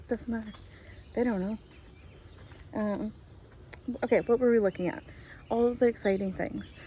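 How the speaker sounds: ordinary talk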